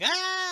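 high, loud mock scream; imitated scream. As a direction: act out the fleeing girl's shriek. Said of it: "And she's like".

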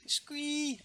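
high little squeak; droid squeal. Retold or imitated. The mouse droid squealing, high and quick.

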